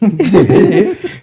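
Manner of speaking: high nervous giggle; nervous laugh. Retold or imitated.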